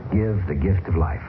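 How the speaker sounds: usual speaking voice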